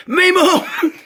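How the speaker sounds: high yelped cry of panic; retold panicked yelp, laughing; cry of alarm. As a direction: squeal it out, mock panicked kid. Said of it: "Giz cries out".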